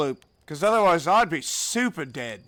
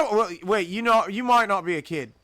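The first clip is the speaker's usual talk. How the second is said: usual speaking voice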